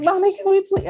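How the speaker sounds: put-on high, pleading little-kid voice; child pleading with her mom, in a high begging voice. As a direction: beg like a small child. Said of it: "I begged my mom".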